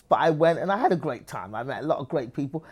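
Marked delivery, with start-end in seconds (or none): none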